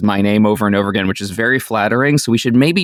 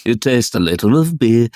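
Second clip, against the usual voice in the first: put-on Liverpool accent. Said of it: "my Liverpool accent".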